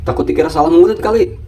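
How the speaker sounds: ordinary delivery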